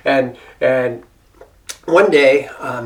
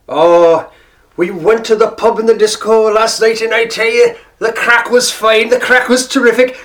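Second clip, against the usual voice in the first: put-on higher, lilting Irish accent; imitated Irish accent; 5 semitones higher, 3 dB louder than usual. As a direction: imitate an Irish pubgoer enthusing about last night. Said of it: "another one that was really, uh, common".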